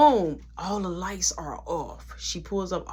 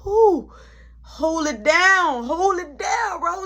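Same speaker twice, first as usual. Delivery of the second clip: excited to himself; 11 semitones higher, 7 dB louder, much slower than usual. Retold or imitated. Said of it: "I was just like".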